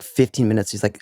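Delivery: normal talking voice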